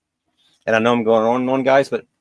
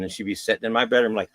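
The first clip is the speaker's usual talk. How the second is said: usual speaking voice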